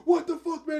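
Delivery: high, loud indignant outburst; mock outraged cop. Cop pulls a gun on the junkie, outraged yell. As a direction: imitate a cop yelling in shock.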